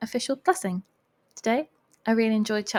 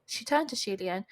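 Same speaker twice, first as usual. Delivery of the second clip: ordinary delivery, nothing marked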